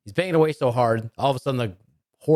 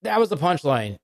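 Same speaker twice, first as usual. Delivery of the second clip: imitated mom; 7 semitones higher, slower than usual. Imitates mom blurting the punchline.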